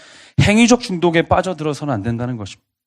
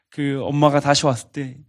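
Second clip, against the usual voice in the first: ordinary delivery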